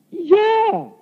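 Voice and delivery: high-pitched, eager reply; eager yes. Retold or imitated. Preacher answering God's offer to show him, high and eager. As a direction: blurt it high, playing the eager one.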